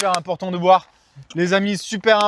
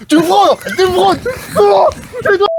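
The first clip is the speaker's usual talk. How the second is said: high-pitched outraged shriek, building; shrieking accusation; 14 semitones higher than usual. Acted. (cri suraigu outré)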